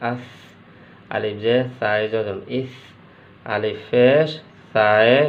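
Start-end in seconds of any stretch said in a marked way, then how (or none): 0.1-0.2 "আস" ending in a softened s; soft sa. Shown as a demonstration. Teacher showing students the letter sa, "আস" with soft ending. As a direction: let the final s of "আস" stay soft. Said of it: "সার উচ্চারণটা একটু নরম হবে".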